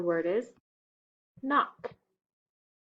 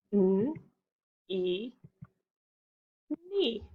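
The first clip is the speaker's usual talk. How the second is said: normal talking voice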